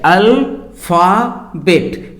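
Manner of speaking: अल्फाबेट slowly as AL, FA, BET; three syllables. Shown as a demonstration. Teacher showing three syllables, अल्फाबेट pulled slowly apart. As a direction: separate अल्फाबेट into three even slow beats. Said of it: "इसमें तीन syllables हैं".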